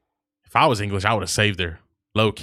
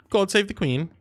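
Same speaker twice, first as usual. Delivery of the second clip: high, hammed-up British accent; mock British accent; 4 semitones higher than usual. Streamer playing a Brit asking for water, mock British accent. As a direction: put on silly glottal-stop British.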